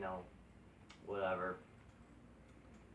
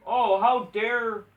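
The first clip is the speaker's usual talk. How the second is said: mock outrage; 8 semitones higher, 9 dB louder, much slower than usual. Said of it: "mocking AEW complaint".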